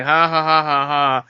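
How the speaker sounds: spoken ha-ha laugh, put on; mock audience laughter. Moviegoer in the theater amused by a famous line, mock laughter. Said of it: "and you're laughing".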